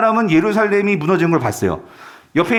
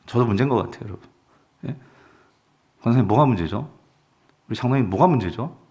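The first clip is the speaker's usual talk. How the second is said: low, softened pastoral musing; subdued questioning; 8 semitones lower, 5 dB quieter than usual. Real, not acted. Pastor asking his own flock what is wrong, subdued. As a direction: drop the voice and ask searchingly.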